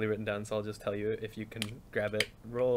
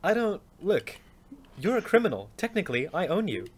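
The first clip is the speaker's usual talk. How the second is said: raised, smug claim of ownership; smug character; 8 semitones higher, 3 dB louder than usual. Acted. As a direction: lord it over him. Acted quarrel in character.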